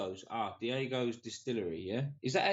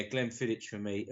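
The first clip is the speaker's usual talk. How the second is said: ordinary talk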